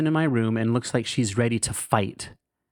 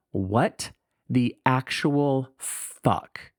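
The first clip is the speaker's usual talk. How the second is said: very slow, each word left hanging; stunned disbelief; much slower than usual. Retold or imitated. Inspecting mom's mouth for a rash, slow stunned disbelief. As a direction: draw it out word by word, incredulous.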